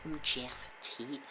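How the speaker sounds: put-on slow, insistent poor Scouse accent. Jeff Tate insisting on his name in Scouse. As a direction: insist on the identity slowly in Scouse. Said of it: "such a poor Scouse accent as that".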